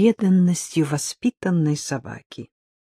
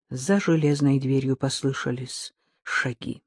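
ordinary delivery, nothing marked

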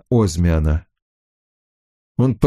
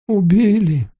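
едва выдавил, высоко и сдавленно; выдави слово через силу; с трудом выдавленное слово; 9 semitones higher than usual. A narrator's reading. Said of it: "с трудом выдавил он". (потрясённо, с трудом)

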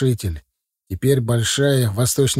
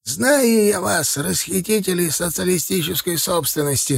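put-on high, elderly accusing voice; indignant old man character; old man's voice; 5 semitones higher than usual. A narrator's reading. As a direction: accuse like a suspicious old watchman, pitched up. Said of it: "сказал старик".

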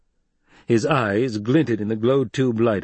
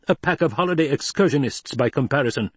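mildly snarling, higher-pitched retort; snarled contempt; 7 semitones higher than usual. A narrator's reading. Hawat belittles the Baron's legions, snarling. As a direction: throw it back with a touch of snarl. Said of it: "Hawat snarled".

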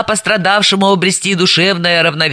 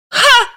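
mocking burst of laughter; evil laugh. A narrator's reading. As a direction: laugh in scorn. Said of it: "Зло рассмеялась она".

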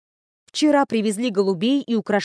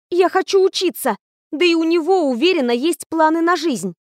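holding back a shout, much higher; almost shouting; 6 semitones higher, 3 dB louder than usual. Acted. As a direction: nearly yell it, just short of shouting. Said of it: "почти кричала я". (почти крик)

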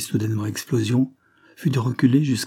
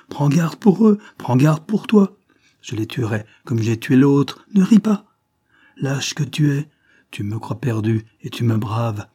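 furious; 4 dB louder than usual. Acted. Jealous convict threatening his mistress, furious shouting. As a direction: threaten her to her face. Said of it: "en redevenant furieux".

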